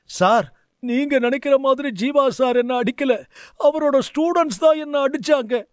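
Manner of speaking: a flustered student correcting his teacher; nervous boy. Acted. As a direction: anxiously set the teacher straight. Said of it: "Ravi பதட்டப்பட்டு".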